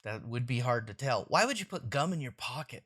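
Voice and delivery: plain reading voice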